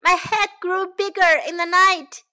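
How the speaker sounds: high, loud complaint; calling out. A narrator's reading. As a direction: wail it to someone in another room. Sam calls for help.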